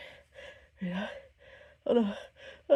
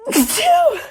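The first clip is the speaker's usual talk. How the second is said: high explosive sneeze; loud sneeze; 22 dB louder than usual. Real, not acted. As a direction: sneeze sharply.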